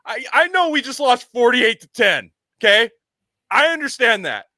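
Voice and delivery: high, loud, emotional coach consoling the team; hammed-up teary speech after a big loss; mock weepy coach. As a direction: coach after a blowout loss, rallying his players through mock tears.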